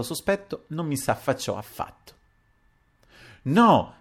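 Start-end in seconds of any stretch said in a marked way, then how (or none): 3.4-4.0 loud, high-pitched squeal of refusal; startled high shriek. A narrator's reading. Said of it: "strillò a un certo punto la signorina Pantogada".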